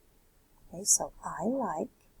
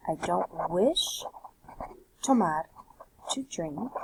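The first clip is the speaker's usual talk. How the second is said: normal talking voice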